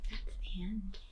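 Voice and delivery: unvoiced hushed ending; ending story time in a whisper. Real, not acted. Closing the story.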